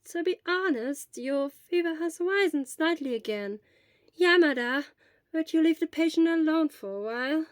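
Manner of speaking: put-on gently firm old doctor sending a visitor away; lightly voiced old nurse. Recovery Girl voiced, old nurse.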